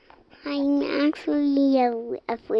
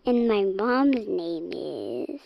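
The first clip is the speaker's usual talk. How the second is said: ordinary talk, nothing marked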